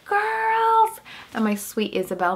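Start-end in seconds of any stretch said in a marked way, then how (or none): 0.1-1.0 high, drawn-out excited greeting; imitated joyful mom greeting; excited calling out. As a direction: call out to the kids, pitched way up. Retold greeting to her daughters.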